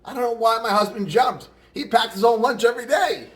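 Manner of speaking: high, loud joke voice for a widow; baffled Polish wife. Acted. Polish wife at the funeral, mock-baffled complaint. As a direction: play a bewildered wife delivering the punchline. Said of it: "And the Polish guy's wife said".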